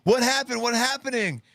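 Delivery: high-pitched imitation of a panicked bystander; mock frantic questioning. Host mimicking the confused woman in the clip, high mock panic.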